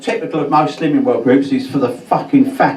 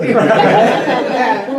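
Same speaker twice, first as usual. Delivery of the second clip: laugh; 9 semitones higher than usual. Real, not acted. Laughs at own joke.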